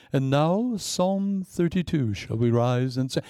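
slow pompous church reading; mock a stiff service; stuffy liturgy. Mocking staid church.

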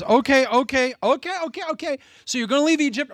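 a cornered ruler caving in; exasperated Pharaoh. Retold or imitated. Pharaoh giving up, high and frantic with Moses.

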